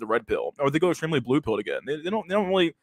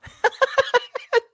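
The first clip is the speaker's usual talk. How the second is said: high laugh. Real, not acted. Laughing at the scripted confession.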